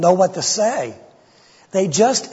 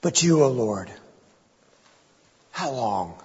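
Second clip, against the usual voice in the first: broken, fading plea; 4 semitones lower than usual. Acted. David too broken to finish his prayer, fading plea. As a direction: let the voice break and give out. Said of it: "David can't even complete the sentence".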